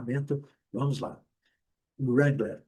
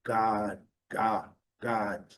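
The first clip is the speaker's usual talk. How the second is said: British "guard" with no R sound; long open vowel in British "guard", R dropped; British pronunciation; 2 semitones lower, much slower than usual. Shown as a demonstration. Teacher showing how Britons say guard, British R-less vowel. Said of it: "os britânicos falam".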